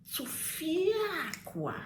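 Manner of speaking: Tufiakwa stretched long and high on "fia"; emphatic exclamation. Shown as a demonstration. Explaining what Tufiakwa means, in a drawn-out high cry. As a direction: let the middle of Tufiakwa soar and linger. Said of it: "You say Tufiakwa".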